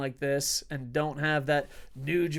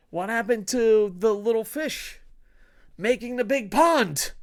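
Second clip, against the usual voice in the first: mock question; 5 semitones higher, 4 dB louder than usual. Mocking rhetorical question.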